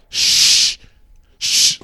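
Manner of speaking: imitated forceful, drawn-out shush; loud shushing. Dad in a theater shushing his crying baby, mock loud shush. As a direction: shush hard, like an exasperated dad.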